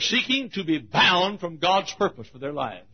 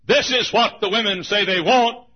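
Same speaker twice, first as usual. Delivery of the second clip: raised mock demand; 5 semitones higher, 6 dB louder than usual. Mocking what groups demand.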